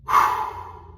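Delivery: long breathy sigh of relief; relieved sigh. Real, not acted.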